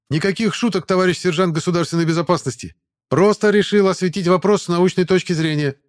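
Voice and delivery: loud, high, brisk soldier reply; cheerful voice. Acted. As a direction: keep it peppy and innocent. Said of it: "всё таким же бодрым голосом".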